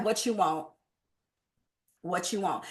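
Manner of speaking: low, soft, wary phone answer; suspicious. Acted. Trainer voicing a called customer who wants to hang up, suspicious. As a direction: imitate someone guarded, dropping low and quiet. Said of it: "they suspicious".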